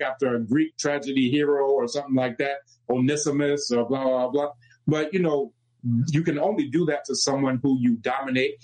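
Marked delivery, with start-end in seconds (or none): none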